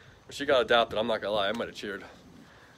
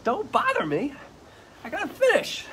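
high squeaky pretend dog voice; voicing the dog; 4 dB louder than usual. Owner speaking for the busy dog in a high squeaky voice. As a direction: put words in the dog's mouth, high and silly.

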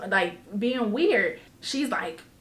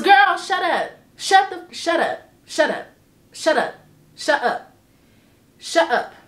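exasperated shut up; 5 semitones higher, 3 dB louder than usual. Real, not acted. Mock scolding friend's excuse.

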